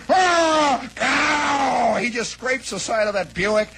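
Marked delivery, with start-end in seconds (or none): 0.1-2.0 high, drawn-out double howl; imitated crash yell. Storyteller acting out the truck scraping a Buick, high mock howl. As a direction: howl out the sideswipe in two bursts. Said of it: "and this guy goes".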